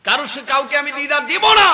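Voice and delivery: high, loud cry rising at the end; impassioned refusal. Retold or imitated. Preacher as the Prophet refusing visitors, impassioned. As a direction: declare it at full force.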